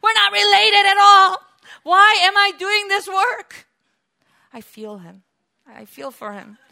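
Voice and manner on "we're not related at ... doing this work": much higher, louder cry to the Lord; tired leader crying out to God about his people; anguished outburst. Acted. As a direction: burst out in weary frustration. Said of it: "He said".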